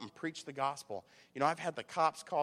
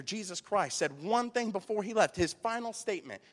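raised, punchy sermon delivery; emphatic preaching; 4 semitones higher, 8 dB louder than usual. Real, not acted. Preacher asks church what salvation is for, emphatic preaching. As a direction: preach it with rising force.

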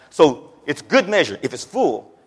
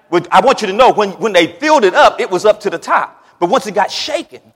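louder, higher, driving delivery; emphatic preaching; 7 semitones higher, 9 dB louder than usual. Real, not acted. Preacher insisting to congregation that baskets were filled up, loud and emphatic. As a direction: drive each point home to the congregation.

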